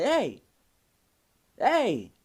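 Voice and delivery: short, loud burst, acted as a pimp; pimp's sharp warning. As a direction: call it out like a threatened slap. Acting out pimp hand warnings.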